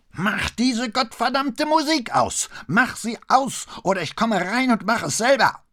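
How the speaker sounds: a very high, loud, slowed shriek; faking total hysteria to lure the man out; shrieking, beside himself. Pretend. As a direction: screech like you've completely lost it. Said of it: "ging in eine höhere Tonlage und kreischte".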